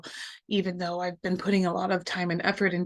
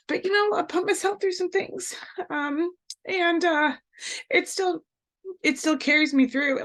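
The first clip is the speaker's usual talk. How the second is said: fighting back real tears; teary-eyed; 9 semitones higher than usual. Real, not acted. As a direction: voice high and close to tears. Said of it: "I might get a little bit teary-eyed".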